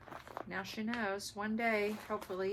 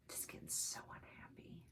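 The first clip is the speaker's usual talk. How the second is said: whispered complaint; 2 semitones lower, 15 dB quieter than usual. Real, not acted. Eyes burning, whining.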